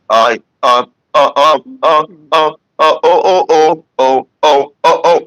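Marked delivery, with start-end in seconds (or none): 1.1-5.2 loud punchy uh-oh syllables; testing echo with vocal bursts. Shown as a demonstration. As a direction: bark short syllables to test the echo. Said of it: "It just feels really echoey to me".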